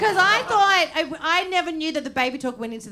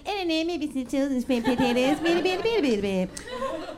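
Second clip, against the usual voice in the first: put-on demonstrated baby talk. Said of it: "I was kinda, like, doing it to".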